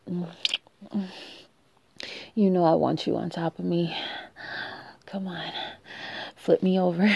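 normal talking voice

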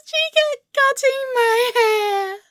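high, drawn-out tearful lament; distraught wail. Acted. Telling friend the hairdresser went on cutting, distraught wail. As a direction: wail slowly, close to crying.

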